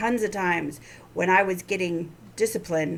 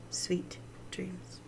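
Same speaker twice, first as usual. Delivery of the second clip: whispering; 7 dB quieter, much slower than usual. Real, not acted. Soft goodnight sign-off.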